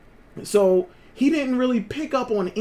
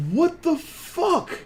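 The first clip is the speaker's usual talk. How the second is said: stunned disbelief; 7 semitones higher, much slower than usual. Retold or imitated. Disbelief at the family's reaction.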